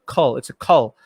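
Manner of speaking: low "cull" for the end of local; British syllable. Shown as a demonstration. Host shows the British ending of local, stressing "cull". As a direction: isolate "cull" as the British sound. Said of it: "So British pronunciation's local".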